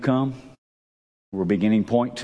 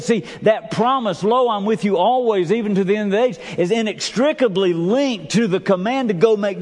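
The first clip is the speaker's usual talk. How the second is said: higher-pitched, driving sermon delivery; preaching; 7 semitones higher than usual. Acted. Preaches with rising force.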